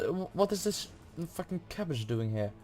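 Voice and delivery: ordinary talk, nothing marked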